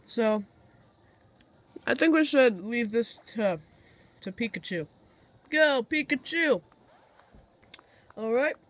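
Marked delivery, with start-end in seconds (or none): 1.9-8.6 talking while munching pretzels; crunching chips. Real, not acted. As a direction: eat crunchy snacks between words. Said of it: "Sorry, I'm eating pretzels".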